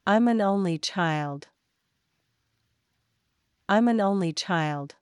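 ordinary delivery

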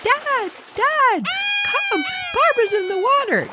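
high, frantic call for help; panicked yell. Retold or imitated. Mom calling dad from the beach in a high panicked yell. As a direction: shriek for Dad to come.

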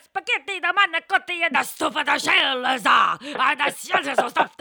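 loud, high, hammed-up Italian accent, laughing; mock Italian shout. Playing along with cohost in a loud Italian accent. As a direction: shout the menu like a cartoon Italian. Joining the Italian guy bit.

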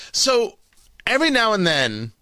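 ordinary talk, nothing marked

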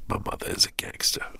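whispered in disbelief, no voice at all; awed whisper. Retold or imitated. Son's inner thought about his smuggler mom, whispered. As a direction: breathe it like a secret you can't believe.

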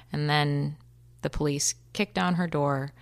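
ordinary delivery

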